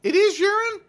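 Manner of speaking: high goofy yokel voice; silly high voice. Pretend. Continues mock winery bit.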